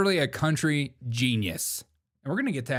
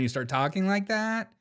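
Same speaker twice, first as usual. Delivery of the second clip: put-on Valley girl upspeak, rising at the end; mock Valley girl; 5 semitones higher than usual. Host mocking the director's upspeak, mock Valley girl. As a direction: mimic a Valley girl lilt.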